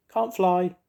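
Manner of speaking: high, small bird voice; a tiny timid bird speaking; little bird voice. A narrator's reading. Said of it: "said the little bird".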